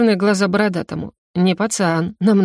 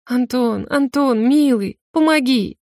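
pleading for help; 5 semitones higher, slower than usual. A narrator's reading. Girl begs for rescue.

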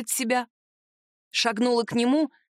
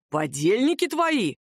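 angry hiss; slower than usual. A narrator's reading. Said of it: "злобно прошипел".

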